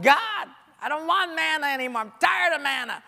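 complaining whine. Retold or imitated. Israelites griping to God about manna, whiny. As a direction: gripe at God like tired kids.

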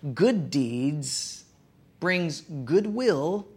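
slow, deliberate phrase, each word weighted; slogan spelled out. Shown as a demonstration. Pastor coining a motto for the congregation, slow and deliberate. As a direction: lay "good deeds brings goodwill" out slowly, word by word. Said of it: "I sort of say it this way".

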